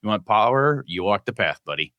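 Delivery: usual speaking voice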